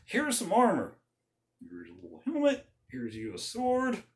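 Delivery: high, mock-surprised king handing over armor; king sending a boy to fight; doubtful king. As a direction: humour the boy like a skeptical king. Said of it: "the king of Israel's like".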